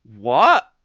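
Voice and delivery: loud, disbelieving what; startled reply to the masseuse's question, loud; shocked what. Retold or imitated. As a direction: blurt it out in shock. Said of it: "And I'm like".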